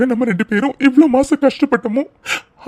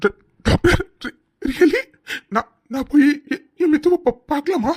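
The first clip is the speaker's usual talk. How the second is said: stammering in soft disbelief; halting, hushed, hardly believing it; stunned joy; 4 dB quieter than usual. Acted. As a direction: falter breathlessly over each word, overwhelmed. Stunned by the pregnancy news.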